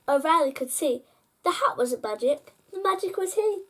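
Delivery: usual speaking voice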